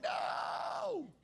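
a high, startled mock shout of refusal. Pastor playing himself refusing God, with a high mock shout. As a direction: yelp it out in pretend panic.

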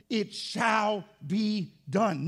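"It shall be done" word by word, very slow; firm declaration. Shown as a demonstration. Preacher declaring faith over hope, "shall be done" slow and emphatic. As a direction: give each word of "shall be done" its own weight. Contrasts certainty with mere hope.

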